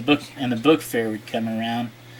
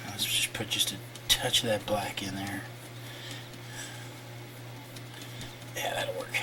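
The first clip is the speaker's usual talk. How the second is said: soft unvoiced whisper; 4 semitones lower, 15 dB quieter than usual. Real, not acted. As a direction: breathe the words while concentrating. Working quietly while painting.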